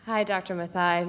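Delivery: ordinary talk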